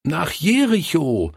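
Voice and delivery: put-on high child's voice calling the answer; children calling out. A narrator's reading. Children helping the forgetful pastor, calling out. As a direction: call out the answer, pitched high. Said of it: "riefen sie".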